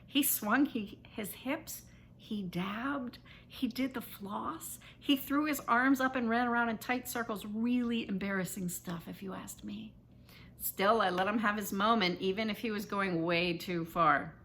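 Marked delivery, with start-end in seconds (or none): none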